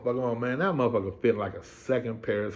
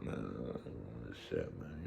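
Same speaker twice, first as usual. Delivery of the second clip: much lower, much softer muttered inner voice; inner grumble; 13 semitones lower, 17 dB quieter, much slower than usual. Retold or imitated. Naked inmate muttering to himself, low grumble. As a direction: grumble it to yourself, deep and low.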